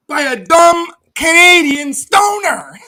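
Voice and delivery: loud, high mocking jab at his rival; mocking shout. Real, not acted. Gloating at rival, loud and high.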